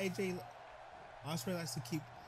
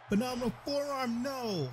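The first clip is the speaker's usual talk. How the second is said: excited call; 6 semitones higher than usual. Real, not acted. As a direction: call the move with a jolt. Big move reaction.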